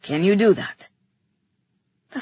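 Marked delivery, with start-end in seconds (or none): 0.0-0.9 lightly voiced low male character; lower-pitched, pressing question; Theron's urgent question. A narrator's reading. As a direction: ask it low and firm. Theron questions the frightened narrator.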